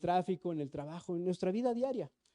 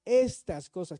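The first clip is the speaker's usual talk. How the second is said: "estas" hit louder; pointing out the key word "estas" to the congregation; stress on estas; 5 dB louder, slower than usual. Shown as a demonstration. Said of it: "¿te das cuenta que dice".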